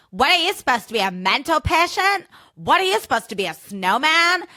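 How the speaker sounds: mock-rude partygoer voice; rude mocking quote. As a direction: sneer at the costume like a rude partygoer. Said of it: "they were so fucking rude about my costume".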